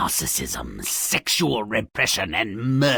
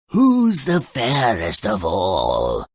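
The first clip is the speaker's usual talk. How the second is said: lightly voiced queen asking slowly; a hint of the anxious queen at her mirror; vain queen; 5 semitones lower, slower than usual. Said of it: "constantly asks the truth-telling looking glass".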